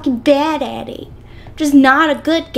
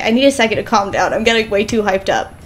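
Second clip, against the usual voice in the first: ordinary talk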